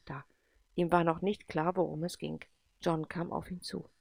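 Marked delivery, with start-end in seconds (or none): none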